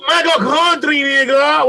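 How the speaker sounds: high Nigerian-accented mimicry; mock Nigerian accent. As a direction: imitate the attacker with a Nigerian accent.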